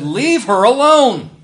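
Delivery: Jesus rebuking the critics, angry and protective. Retold or imitated. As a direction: snap it out to defend her. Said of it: "I don't think he said that in a nice way".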